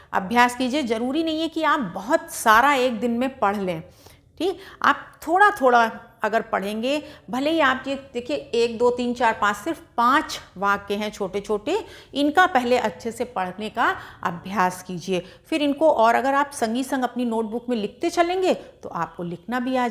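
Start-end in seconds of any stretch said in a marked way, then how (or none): none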